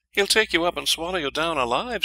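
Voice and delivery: high, urgent warning; princess voice. A narrator's reading. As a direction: warn him urgently. Princess warns of troll.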